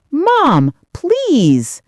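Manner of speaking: high, loud, urgent plea; pleading. A narrator's reading. Son begs mother not to send him away, pleading.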